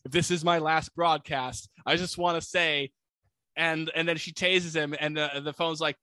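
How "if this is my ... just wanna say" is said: ordinary delivery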